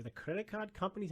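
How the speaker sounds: light Indian accent imitation of the professor. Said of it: "in his Indian accent".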